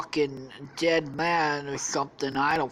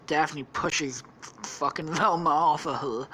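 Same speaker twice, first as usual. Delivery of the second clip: ordinary delivery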